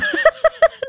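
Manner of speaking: sudden high-pitched laugh; a squealing laugh that breaks out; high burst of laughter. Real, not acted. Host laughing at a Gen X listener's jab, high squeal.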